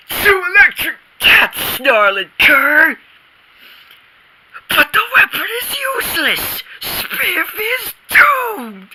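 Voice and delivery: high, breathless pulp-hero announcing; milk the doom for all it's worth; melodramatic narration. Acted. Boy narrates his space hero's doom, high and melodramatic.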